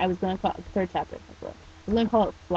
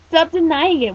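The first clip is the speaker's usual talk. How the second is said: raised, loud amused chiding; teasing; 9 semitones higher, 10 dB louder than usual. Acted. Shop owner leaning on doorframe teases teen, amused chiding. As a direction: chide him playfully. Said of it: "Yuko chuckled".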